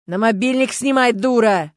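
loud angry shout; yell. A narrator's reading. Stas yells at Lyuska, loud and angry. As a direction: yell it while running out. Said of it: "заорал он".

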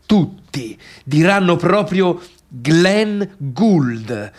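slow, pointed stress on Glenn Gould; stressed name. Shown as a demonstration. Narrator mocking the guests, stressing the name slowly. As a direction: spell out the name slowly for everyone. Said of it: "lo diranno così ad alta voce".

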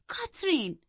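high, sharp cry of the name; angry cry. Acted. Isabella crying out at Catherine in anger. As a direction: snap her name in outrage. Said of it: "ایزابلا با عصبانیت داد زد".

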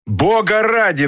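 high, drawn-out outburst of irritation; infuriated, slow and high-pitched exclamation; exasperated cry. A narrator's reading. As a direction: cry out at your wits' end. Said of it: "вскричал Ролф".

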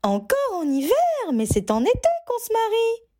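high, indignant mother's voice; protesting mother. Acted. Mother protests a winter wedding, high and indignant. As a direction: push back like a shocked mum. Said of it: "protesta sa mère".

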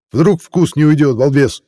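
high, slow scolding; exasperated scolding. Acted. Cook scolding his brother.

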